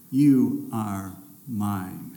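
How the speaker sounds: slow, low and tender, lightly voiced; God declaring. The triune God declares to the baptized child, slow and low. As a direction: speak it slowly as God's claim. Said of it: "the triune God declares".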